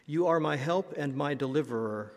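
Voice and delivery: ordinary talk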